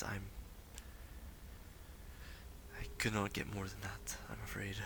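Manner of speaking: soft, halting, apologetic; lightly voiced weary seer. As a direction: let it trail off, lightly in character as a drained mystic. Game master voices a drained seer.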